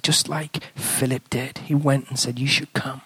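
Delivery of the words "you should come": normal talking voice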